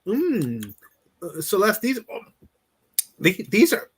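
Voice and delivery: high, hesitant fake praise; overly kind friend. Coach mimicking a too-nice friend praising bad potatoes, high and hesitant. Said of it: "So they go".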